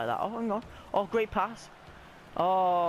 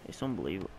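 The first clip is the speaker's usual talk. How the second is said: ordinary talk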